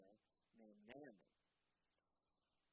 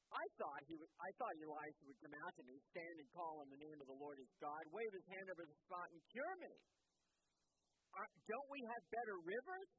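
loud, high indignant rant; angry Naaman; 5 semitones higher, 7 dB louder than usual. Acted. Naaman complaining to his servants, loud angry rant. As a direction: Naaman fuming at the prophet's orders. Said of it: "Naaman gets really, really angry".

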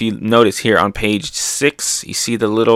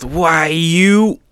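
raised, drawn-out mock strain; straining struggle; 7 semitones higher than usual. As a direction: push it out like prying jaws open. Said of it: "trying to open his mouth".

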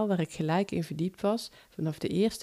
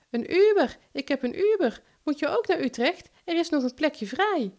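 high, loud, breathless with excitement; girl running up thrilled about her Uber ride; excited girl; 10 semitones higher, 3 dB louder than usual. A narrator's reading. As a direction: burst out with the good news, much higher. Girl rushes up, wide eyes.

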